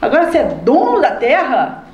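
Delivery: high, indignant rebuke. Acted. Preacher rebuking a selfish complainer, high and indignant. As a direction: challenge the complainer in disbelief.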